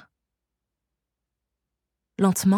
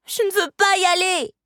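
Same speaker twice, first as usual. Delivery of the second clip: whining wail; 11 semitones higher than usual. A narrator's reading. Girl clinging to the sorceress's robe, wailing protest. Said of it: "gémit Marianne".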